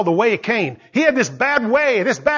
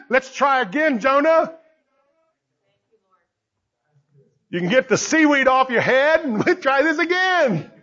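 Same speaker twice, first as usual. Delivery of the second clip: playful God; 3 semitones higher than usual. Acted. God joking to Jonah.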